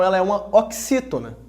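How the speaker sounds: ordinary delivery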